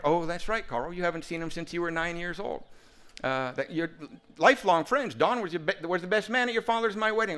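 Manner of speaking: put-on mother recalling old family friends; mom voice. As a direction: remind him like a mother. Mother voiced on phone call.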